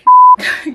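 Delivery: high, loud squeal of laughter; real high-pitched laughing outburst; burst of laughter. Real, not acted. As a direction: crack up loudly, way up high. Cracks up at own confession.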